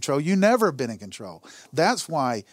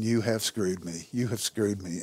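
low, soft, slow bitter accusation; a quiet, slow complaint under the stars; 6 semitones lower, 7 dB quieter than usual. Retold or imitated. Praying alone at night, quiet bitter slow complaint. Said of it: "and I'm going".

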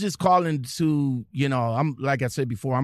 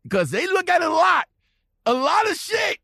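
shouting; 4 dB louder than usual. Real, not acted. Warning drivers about Warner checks.

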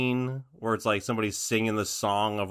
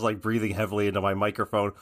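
normal talking voice

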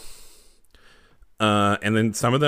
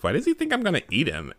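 puzzled Koopa; 6 semitones higher, faster than usual. Acted. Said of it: "he looks at Daisy and he says".

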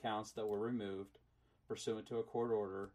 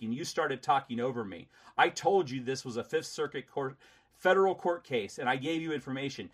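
loud, angry lecturing; 2 semitones higher, 6 dB louder than usual. Real, not acted. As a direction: scold her hard. Heated argument with collector.

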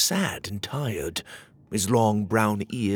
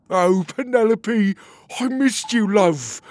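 joyful reunion; 10 semitones higher, 7 dB louder, slower than usual. Acted. Petey reunited with wife.

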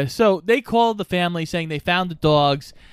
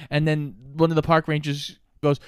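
ordinary talk

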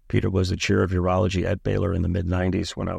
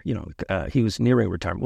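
ordinary delivery

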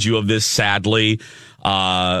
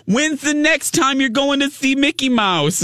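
very high doting question; screechy Marianne. Retold or imitated. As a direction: ask in a squeaky Brooklyn fan voice. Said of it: "a really screechy voice".

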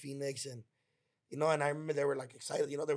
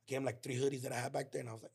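ordinary delivery

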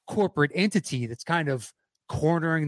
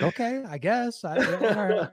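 mock hesitant acceptance, pitched up; bewildered going-along; 8 semitones higher than usual. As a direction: go along with it, audibly unsure. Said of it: "And he's like".

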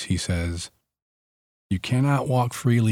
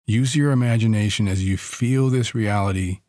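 guided meditation. Acted.

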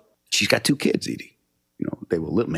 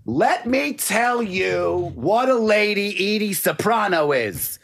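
high, slow, emphatic mother voice; loud mother; 9 semitones higher, slower than usual. Retold or imitated. Son imitating his mother raving about the letter, high and slow. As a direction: lay it on like an impressed Italian mom. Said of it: "and she's loud".

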